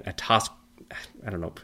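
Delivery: normal talking voice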